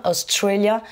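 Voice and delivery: Australia with TRA emphasised; stress on second syllable. Shown as a demonstration. Teacher separating it from Austria, stress on TRA in Australia. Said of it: "j'ai accentué sur la deuxième".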